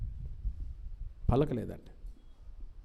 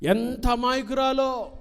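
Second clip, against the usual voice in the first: raised, drawn-out ఎంత అమాయకురాలో; high slow mock wonder; 8 semitones higher, slower than usual. Shown as a demonstration. Preacher mocking parents' view, high and slow. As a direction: marvel slowly at the daughter's innocence. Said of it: "repeated phrase".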